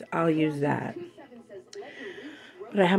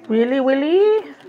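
higher, louder mock-exasperated really Willie; exasperated at the cat; 7 semitones higher, 4 dB louder than usual. Real, not acted. Owner scolding her cat Willie, exasperated and higher. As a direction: scold the pet with fond disbelief.

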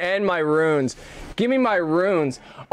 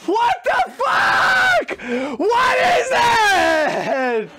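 much higher, loud disbelieving shouts; real gamer panic after dying at the same time as the boss; shocked yelling; 12 semitones higher, slower than usual. Real, not acted. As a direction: scream at the screen in total disbelief. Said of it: "Sorry if I was loud".

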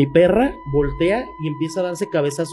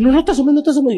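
high, louder frantic plea; pleading girlfriend; 11 semitones higher, 5 dB louder than usual. Retold or imitated. Girlfriend begging the narrator not to look, high frantic plea.

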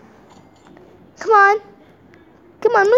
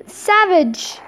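ordinary talk, nothing marked

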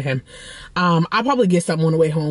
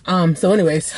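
normal talking voice

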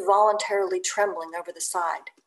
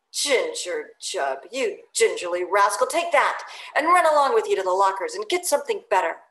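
scornful; 6 dB louder than usual. Acted. Stubb scolding Doughboy.

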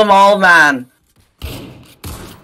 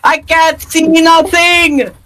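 frustrated yell; 10 semitones higher, slower than usual. Real, not acted. Frustrated gamer yelling.

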